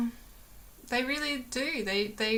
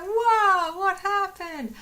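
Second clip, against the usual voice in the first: much higher and much louder, squealing with wonder; excited rabbit voice; 10 semitones higher, 8 dB louder, slower than usual. Retold or imitated. Voicing Lois the rabbit discovering her sick friend, hugely excited. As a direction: gush like an overexcited little bunny. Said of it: "She was so excited".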